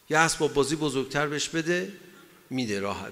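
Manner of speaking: normal talking voice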